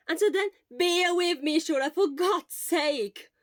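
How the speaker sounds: loud, high exasperated plea. Acted. Friend loudly pleading with Shota to bear with him. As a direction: beg him to put up with you.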